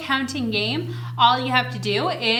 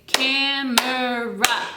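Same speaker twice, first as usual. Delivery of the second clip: Camera drawn out slowly, each syllable apart: cam-er-a; much slower than usual. Shown as a demonstration. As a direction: stretch Camera into three clear beats. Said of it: "But I would say".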